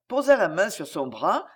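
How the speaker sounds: usual speaking voice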